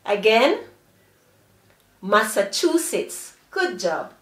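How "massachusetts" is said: "Massachusetts" as one joined word; quicker, connected "Massachusetts"; blended together. Shown as a demonstration. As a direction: run "Massachusetts" together without pauses. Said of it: "Blend them quickly together".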